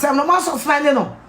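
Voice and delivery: ordinary delivery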